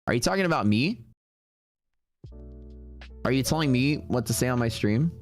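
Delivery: ordinary talk, nothing marked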